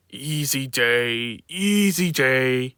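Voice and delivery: slow drawn-out yawning words. Acted. Sleepy Richard yawns about his easy day. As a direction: stretch it out through a yawn. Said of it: "he yawned".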